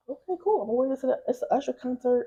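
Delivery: high-pitched mock reply of a woman game for it; imitating breezy agreement. Acting out Keke's breezy reply.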